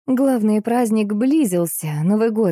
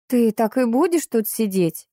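displeased; 4 semitones higher than usual. A narrator's reading. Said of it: "недовольно покосилась".

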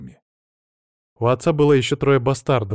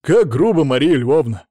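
breezy driver; 3 semitones higher, 6 dB louder than usual. A narrator's reading. Driver answers the sword's insult, breezily unfazed. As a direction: toss it out loudly, untroubled. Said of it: "как ни в чём не бывало".

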